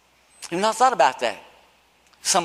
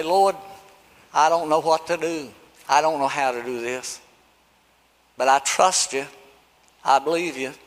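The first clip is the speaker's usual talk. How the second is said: humble prayer; 6 semitones lower, 6 dB quieter than usual. Pretend.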